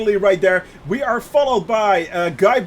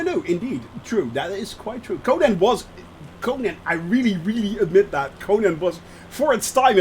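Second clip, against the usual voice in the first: ordinary talk, nothing marked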